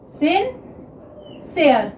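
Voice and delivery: Sincere stretched out very slowly; dictating sincere slowly to students; slow dictation. Shown as a demonstration. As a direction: draw out sincere syllable by syllable while writing. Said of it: "next देखिए sincere".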